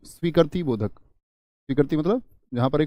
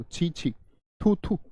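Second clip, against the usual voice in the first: घृणा; 3 semitones higher, 6 dB quieter, much slower than usual. Shown as a demonstration. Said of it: "जब घृणा पैदा हो".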